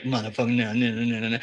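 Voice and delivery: a deflated mock no under the breath; mock dismay. Imitating a dismayed reaction.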